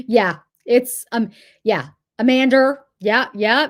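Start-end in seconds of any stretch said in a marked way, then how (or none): 2.2-2.8 "Amanda" ending in an R, as "Amander"; Boston added R. Shown as a demonstration. Local agrees with viewer's example, Boston added R. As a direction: put an R on the end of "Amanda". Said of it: "There's no R at the end".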